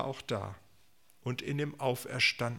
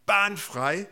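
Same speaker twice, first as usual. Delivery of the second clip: higher, louder prophetic call; proclaimed call; 7 semitones higher, 7 dB louder than usual. Retold or imitated. Preacher echoes Isaiah's call, ringing out. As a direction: call it out like a herald. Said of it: "in diesem Aufruf Jesajas".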